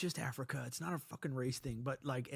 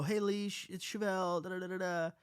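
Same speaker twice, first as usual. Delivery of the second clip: lightly higher calm daughter; calm girl voice; 5 semitones higher than usual. Retold or imitated. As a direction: calmly chirp it like a teen girl. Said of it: "not gonna be calm and go".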